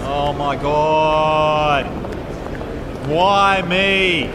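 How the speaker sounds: slow loud high lament; despairing wail. Acted. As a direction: wail it to the sky. Despair over lost bag.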